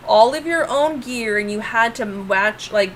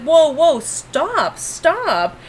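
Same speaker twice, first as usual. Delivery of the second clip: raised, pleading stop; alarmed stop; 6 semitones higher, much slower than usual. Retold or imitated. Retelling players steamrolling her, raised pleading stop. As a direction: halt the table. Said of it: "I'm like".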